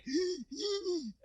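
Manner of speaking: sharp, high gasp of pain. Retold or imitated. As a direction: suck in air as the back seizes. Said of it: "I'm literally like".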